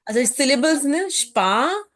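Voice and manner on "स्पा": स्पा said as one long syllable, "shpaa"; syllable demo. Shown as a demonstration. Teacher breaking a German word into syllables, slow syllable demo. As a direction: draw out स्पा as a separate first syllable. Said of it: "syllable समझना जरूरी होता है ना".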